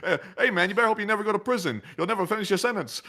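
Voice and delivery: high, teasing imitation; amused impression of a heckler; mock heckler comeback. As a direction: deliver a cocky jibe with a laugh behind it.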